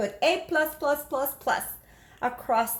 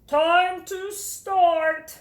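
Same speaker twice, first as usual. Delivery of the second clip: very slow, higher and louder; drawn-out teacher call; 6 semitones higher, 6 dB louder, much slower than usual. Acted. Teacher starting the spelling test, very drawn out. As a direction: announce the test start slowly.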